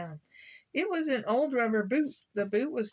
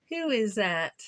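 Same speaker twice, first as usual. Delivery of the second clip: usual speaking voice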